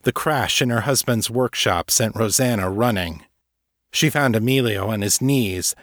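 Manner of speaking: plain reading voice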